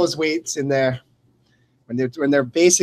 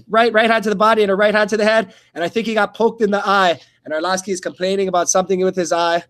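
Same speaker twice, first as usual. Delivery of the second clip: brisk fight call, a touch higher; live play-by-play; 4 semitones higher than usual. Acted. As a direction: call the action as it happens.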